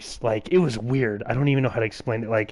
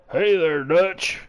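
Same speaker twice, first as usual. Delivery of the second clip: higher, louder, very slow botched cowboy voice; terrible Arthur Morgan; 6 semitones higher, 4 dB louder, much slower than usual. Shown as a demonstration. Last try at Arthur Morgan, judged terrible. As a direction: overdo the outlaw voice badly. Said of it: "That was a terrible one".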